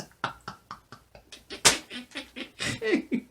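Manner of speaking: high, breathless giggle; helpless squeaky laughing fit; giddy laugh. Real, not acted. Silly at own country accent, giddy laughter.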